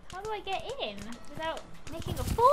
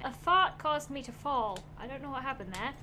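ordinary delivery

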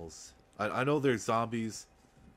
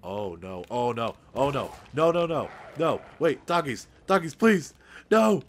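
panicked screaming, high and loud; real terror, shrieking pleas; terrified horror victim; 10 semitones higher, 13 dB louder than usual. Real, not acted. Dogs attack in horror game.

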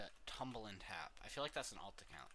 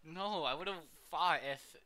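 excited shouting; 8 semitones higher, 10 dB louder than usual. Real, not acted. Excited game talk.

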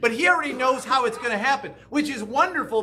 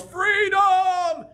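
retold battle cry; 10 semitones higher than usual. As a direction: shout it like the hero on the scaffold. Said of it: "and he yells".

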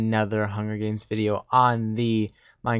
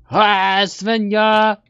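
loud, higher shout of a name; calling out; 11 semitones higher, 11 dB louder, much slower than usual. Real, not acted. Gamer yells to teammate Svenja after making it. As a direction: yell for a friend across the map.

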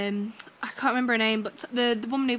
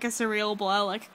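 ordinary talk, nothing marked

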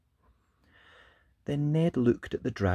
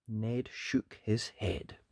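ordinary delivery, nothing marked

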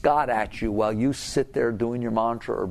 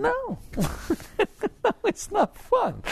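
laughing no; slower than usual. Real, not acted. Answers own question laughing.

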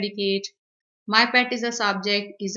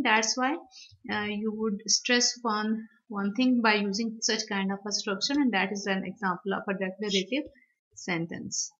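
ordinary delivery

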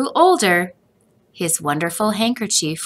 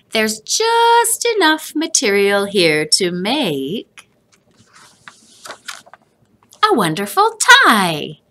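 a grandpa pleased with his idea; grandfather figure; 3 semitones higher, 3 dB louder than usual. A narrator's reading. Grandfather tells Joseph about the tie.

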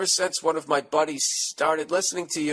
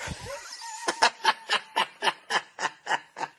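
a long, much higher cackle that fades out; helpless squealing laughter; high shrieking laugh; 3 dB quieter than usual. Real, not acted. Reaction to shocking email.

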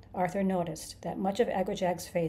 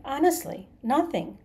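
higher, louder protest of innocence; Arthur, insistent; 7 semitones higher, 6 dB louder than usual. A narrator's reading. Arthur pleads innocence to the creature, insistent. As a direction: insist on it with raised pitch. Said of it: "insisted Arthur".

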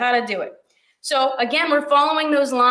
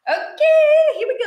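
high loud squeal; excited squeal; 6 dB louder than usual. Real, not acted. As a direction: burst out with a bright yelp. Bursts out after crying moment.

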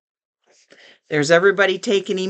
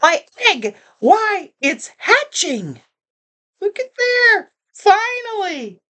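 overjoyed; 12 semitones higher than usual. A narrator's reading. Horton sees egg hatch.

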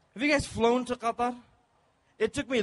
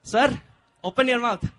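louder, firm steward order; pushy steward; 2 semitones higher, 7 dB louder than usual. Retold or imitated. Steward ordering the comedian to open his mouth, loudly. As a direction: tell him to open up like a demand. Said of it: "This guy comes to me and says".

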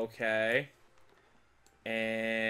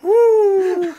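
loud, high triumphant whoop; cheering; 21 dB louder than usual. Real, not acted. Success cheer.

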